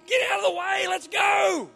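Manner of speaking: impatient shout. Retold or imitated.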